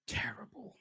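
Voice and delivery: whispered self-mockery. Real, not acted. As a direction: hiss it under your breath at your own joke. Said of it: "laughing at my poetry".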